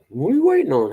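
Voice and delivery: impatient dad. Retold or imitated. Dad voiced in a story.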